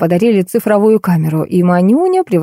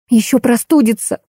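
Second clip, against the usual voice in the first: frightened; 5 semitones higher than usual. Acted. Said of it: "испугалась Маша".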